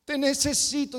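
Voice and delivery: unfaithful husband feigning tears at the altar; mock weeping prayer. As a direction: imitate a man weeping showily at the altar. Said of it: "y llora y dice".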